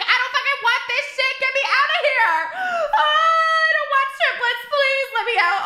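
high, loud wailing plea; hammed-up panic. New mother running out from triplets, hammed-up panic. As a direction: wail it like a desperate escape.

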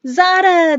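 high, loud, drawn-out call; excited children's shout; joyful cry. A narrator's reading. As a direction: cry out the name in delight. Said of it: "they cried".

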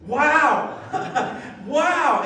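loud, laughing wow; joyful shouted wow with a laugh; excited wow. Real, not acted. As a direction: shout it out in delight.

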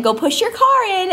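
put-on high, singsong request to a toddler; retold mom instruction.

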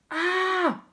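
high-pitched "Ah!"; shocked cry. A narrator's reading. Captain finding his biscuit barrel empty, high cry. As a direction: cry out sharply in dismay. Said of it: "cried the captain".

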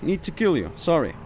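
ordinary delivery

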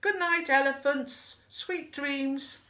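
high, sustained moon voice; high, held-out sing-song goodnight. A narrator's reading. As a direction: call goodnight from the sky. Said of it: "A voice that seemed to come from the moon".

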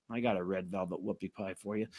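a soft, quick, sly offer; mock sales pitch. Mocking the diet company, a quick soft sales offer. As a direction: slip it to them like a sneaky salesman.

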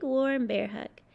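usual speaking voice

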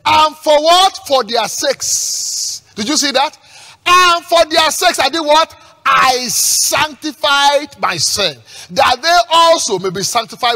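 loud, slow, stretched scripture proclamation; preach. Acted. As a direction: draw out each phrase, throwing questions to the congregation. Preaches at full force.

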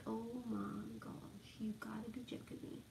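soft fed-up whisper; exasperated whisper. Retold or imitated. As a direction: whisper it, fed up with his snoring. Annoyed at snoring husband at night.

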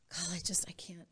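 barely able to say it; a hushed, breaking thought; overwhelmed whisper. Retold or imitated.

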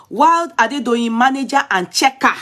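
a touch of newscaster; news read. Acted. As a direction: read the headline out to the viewers. Reads news report aloud.

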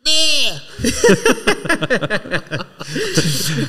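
bleating like a goat on request; long high goat bleat, a party trick. Shown as a demonstration. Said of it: "Gotta hear this".

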